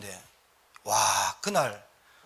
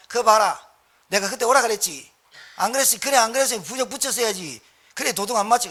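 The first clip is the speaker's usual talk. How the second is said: loud, higher mock shaman scolding the caller; rude shaman scolding; 5 semitones higher, 6 dB louder than usual. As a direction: bark it down like a rude fortune-teller.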